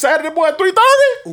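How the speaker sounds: a high, excited call of the kickoff time; hyped up. Real, not acted. Co-host hyping next Saturday's game, excited and high. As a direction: build up the big game.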